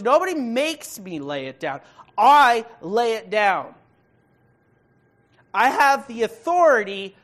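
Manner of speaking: loud, forceful declaration with heavy stress on "I". Acted. Preacher voicing Jesus claiming authority over his life, emphatic. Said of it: "he makes it even more emphatic".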